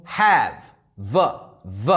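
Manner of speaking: "have" then a held, buzzing "v, v" on its own; stretched v sound. Shown as a demonstration. Teacher isolates the "v" in "have" for students, buzzing. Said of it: "this is an example".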